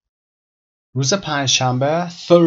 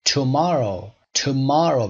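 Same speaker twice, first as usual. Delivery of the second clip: MOR in Tomorrow raised and stressed; stress on middle syllable; 2 semitones higher, much slower than usual. Shown as a demonstration.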